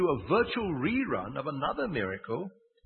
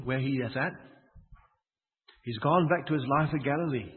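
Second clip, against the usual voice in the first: usual speaking voice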